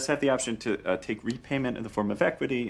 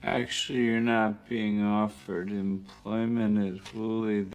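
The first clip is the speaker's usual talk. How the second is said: slowed way down; slower than usual. Shown as a demonstration. Line replayed slowed down.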